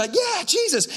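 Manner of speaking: high sung-out cheer; new believer whooping with joy. Pretend. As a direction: whoop it up for Jesus. Cheering after being saved.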